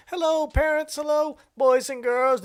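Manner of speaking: put-on high, sing-song little boy greeting; a small boy chirping hello to the class; mock kid voice. Scene: retelling a viral video, mock little boy greeting.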